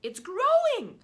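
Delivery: high, loud cry of excitement; high excited aunt cry. Acted. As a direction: squeal it out as the fruit swells. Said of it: "Aunt Spiker cried".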